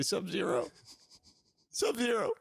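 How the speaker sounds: high, pleading cry for his brother; pleading call. Retold or imitated. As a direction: beg him to answer. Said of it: "You know what I say? I say".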